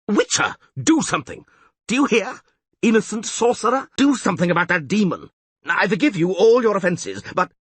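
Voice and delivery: high, loud frantic tirade; panicked angry rant. Acted. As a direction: berate the priest and beg the witcher. Mayor rants in panic.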